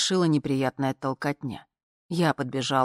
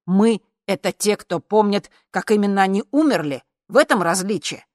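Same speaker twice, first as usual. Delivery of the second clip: raised, emphatic declaration; emphatic, higher, louder pleading; impassioned speech; 5 semitones higher, 5 dB louder than usual. A narrator's reading. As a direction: drive the point home to the room.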